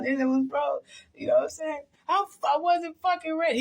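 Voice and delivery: high-pitched imitation of crying talk; mock tearful whine. Retelling her breakdown, not ready for it, high mock-tearful voice.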